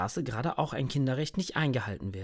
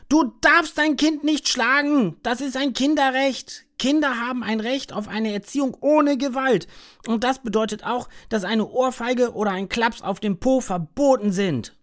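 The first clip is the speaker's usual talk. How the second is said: loud shout; 9 semitones higher, 6 dB louder than usual. A narrator's reading. Max shouts at the mother hitting her child. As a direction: shout it across the street. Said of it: "rennt zur Tür und ruft ganz laut".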